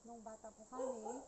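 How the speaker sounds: ordinary talk, nothing marked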